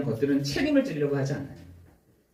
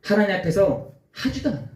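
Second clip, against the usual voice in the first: normal talking voice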